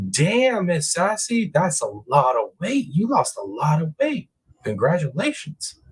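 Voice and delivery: high, impressed exclamation; amazed high congratulations; excited praise. Real, not acted. Reacting to viewer's weight loss.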